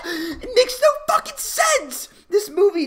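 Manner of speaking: incredulous, cracking up; a critic laughing at how bad the movie is; laughing disbelief. Real, not acted. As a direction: laugh it out way up high.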